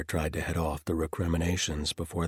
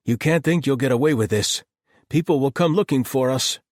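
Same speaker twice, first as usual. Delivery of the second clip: loud, higher angry threat; 9 semitones higher, 11 dB louder than usual. Acted. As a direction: pound the door and rage. Galen furious at captor.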